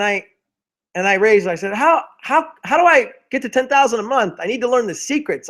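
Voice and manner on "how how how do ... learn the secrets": high, pleading, exasperated question; a new hire's high, exasperated plea. Retold or imitated. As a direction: beg the manager for the answer, voice climbing high. Frustrated new hire pleads.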